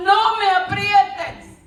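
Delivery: high, stretched-out cry; mock yelled protest. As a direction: cry out slowly as the squeezed cup. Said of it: "la tacita gritaba y decía".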